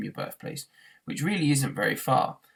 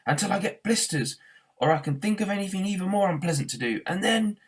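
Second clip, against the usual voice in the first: yell; 7 semitones higher than usual. A narrator's reading. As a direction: yell it while running. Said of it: "yelled Arthur".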